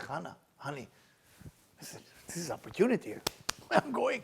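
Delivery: 1 quiet to wife. Retold or imitated. Husband confides in wife Hannah.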